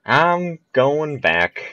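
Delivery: slow high drawn-out whine on going back; drawn-out wail. Real, not acted. Ball rolls back.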